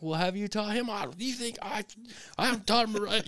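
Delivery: lightly voiced wife snapping back; defensive wife. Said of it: "my wife's like".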